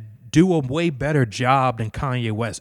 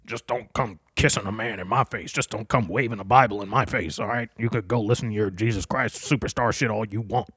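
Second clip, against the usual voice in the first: low, lightly voiced grumpy old man scolding; a hint of a cranky old person waving you off; lower, sneering old-timer voice; mocking old bigot; 5 semitones lower than usual. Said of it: "I'm sounding like one of those old people who hates gays".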